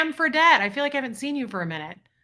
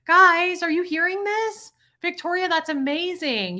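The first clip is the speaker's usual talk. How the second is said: high and loud, thrilled; excited; 8 semitones higher, 3 dB louder than usual. Real, not acted. Streamer urging chat to read the comment, excited. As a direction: call out to the viewers, amazed.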